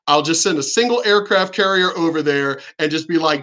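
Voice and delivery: ordinary delivery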